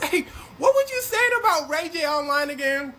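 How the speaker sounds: high, loud teasing question; Kevin Hart instigating. Retold or imitated. Kevin Hart needling Fabulous at dinner, high loud tease.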